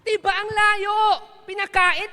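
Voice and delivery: loud excited shout to the crowd. Real, not acted. Preacher shouting at congregation about the far-fetched link. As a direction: rouse the congregation at full volume.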